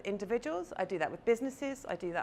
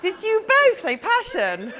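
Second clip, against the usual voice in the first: higher and louder, laughing through it; laughing question; 12 semitones higher, 12 dB louder, slower than usual. Real, not acted. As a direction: laugh in delight as you ask. Two people say the same word.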